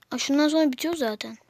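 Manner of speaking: ordinary talk, nothing marked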